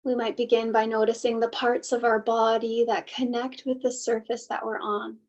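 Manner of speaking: unhurried meditation guide, long pauses between phrases; guided meditation. Acted. Host leading listeners to soften and settle, guided meditation. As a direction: gently guide listeners inward, leaving space after each thought.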